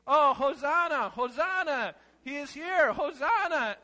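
high, loud sing-song wake-up call; alarm clock shouting. Pretend. Alarm clock shouting hosanna to wake a sleeper, loud and high. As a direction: belt it out like an alarm going off. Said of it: "The alarm clock is screaming".

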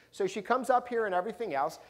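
normal talking voice

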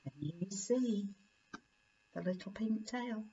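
put-on soft aside to the child; quiet aside. A narrator's reading. Reader asking the child about the tail, soft aside. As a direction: lean in and ask the little listener softly.

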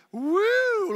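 high, drawn-out "woo"; excited whoop. Real, not acted. Preacher cheering five people at prayer, excited whoop. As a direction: let out a long, high cheer.

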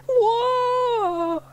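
long, very high "whoa" yell; drawn-out high-pitched cry; boy's yell. A narrator's reading. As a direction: yell out as a boy losing control. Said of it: "yelled James".

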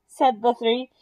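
ordinary delivery

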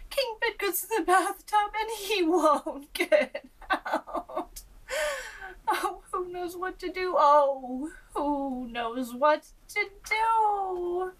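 high plea breaking into a laugh; laughing worried page. A narrator's reading. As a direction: fret loudly, then crack up.

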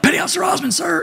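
high-pitched military answer; gung-ho recruit. Retold or imitated. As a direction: answer an officer at attention, far too keen. Acts out recruit answering lieutenant at attention.

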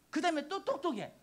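ordinary talk

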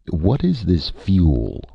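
asked haltingly, with drawn-out words; halting question. A narrator's reading. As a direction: feel your way slowly through an unfamiliar word. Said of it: "the chief asked haltingly".

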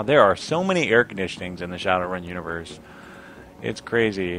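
ordinary delivery

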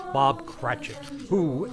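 ordinary delivery

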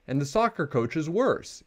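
mild yell. A narrator's reading. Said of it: "yelled Sister".

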